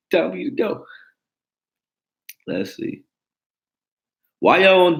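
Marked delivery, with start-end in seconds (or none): 0.1-0.8 whispered farewell, pitched up. Retold or imitated. Whispering his girlfriend out the door. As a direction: breathe it out as a goodbye.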